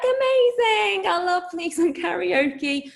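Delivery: high, squealing delight, drawn out; excited squeal. Retold or imitated. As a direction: gush with drunken glee. Drunk excitement over karaoke.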